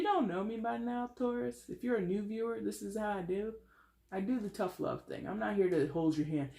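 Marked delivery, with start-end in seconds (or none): none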